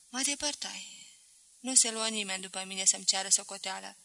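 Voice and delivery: ordinary delivery, nothing marked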